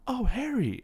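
high, loud happy shout of a name; excited call. Retold or imitated. Ron calling out to Harry, high and loud with delight. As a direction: call out his name, thrilled to see him.

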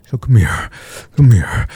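usual speaking voice

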